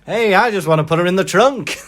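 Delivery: hammed-up American accent, cheerfully insistent. American menacing a family, loud American accent. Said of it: "like me being American".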